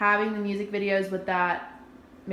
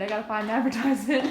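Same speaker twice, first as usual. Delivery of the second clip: quick line breaking into laughter; amused, speedy aside ending in a laugh; faster than usual. Real, not acted. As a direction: rush it out, then laugh. Moderator laughs at own stalling.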